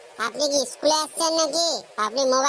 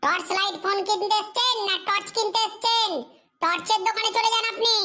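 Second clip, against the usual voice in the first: higher-pitched exasperated retort; 6 semitones higher than usual. Acted. Shopkeeper scoffs at customer wanting torch phone. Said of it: "control".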